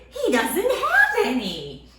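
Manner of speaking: slow, laughing reveal. Real, not acted. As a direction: laugh through the answer.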